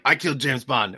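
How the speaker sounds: lightly imitated Faroese accent; thick Faroese accent. Faroese local boasting to tourists, lightly imitated Faroese accent. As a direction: put on a hint of the local's accent. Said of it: "The accent was too thick".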